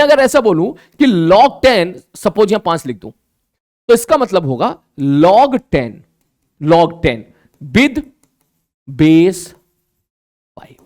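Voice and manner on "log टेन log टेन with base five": "log टेन with base five" word by word, long pauses; slow reading demo. Shown as a demonstration. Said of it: "इस प्रकार से हम बोल के पढ़ते हैं". ("log टेन with base five" एक-एक शब्द रुककर, धीरे)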